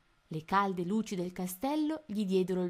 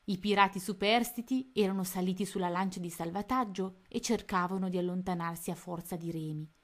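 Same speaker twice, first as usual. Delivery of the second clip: ordinary delivery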